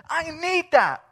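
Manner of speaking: high, loud declaration of need; retold eager plea. Woman responding to news of Jesus, eager. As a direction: imitate her seizing on it, loud and high.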